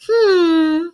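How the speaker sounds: a pointed put-upon sigh from the mother-in-law; imitating a mother-in-law's loaded sigh; passive aggressive sigh. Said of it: "And then go".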